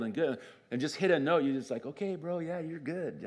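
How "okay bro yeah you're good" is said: softer, higher-pitched appeasing aside; lightly acted, humouring a show-off; mock placating. Listener humours someone blowing a trumpet, mock placating.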